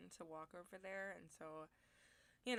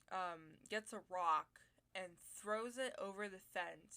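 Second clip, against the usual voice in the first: ordinary talk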